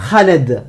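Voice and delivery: "Khaled" starting with a rasped kh; Arabic pronunciation. Shown as a demonstration. Teacher gives an example name, "Khaled" with guttural Arabic kh. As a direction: scrape the first sound of "Khaled" in the throat. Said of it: "Le prénom".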